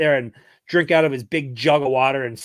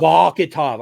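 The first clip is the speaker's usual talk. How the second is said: Vakhitov said louder in a mock Russian accent; Russian accent attempt; 3 semitones higher, 7 dB louder, slower than usual. Shown as a demonstration. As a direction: punch out Vakhitov like a Russian would. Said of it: "I was supposed to say it like I'm Russian".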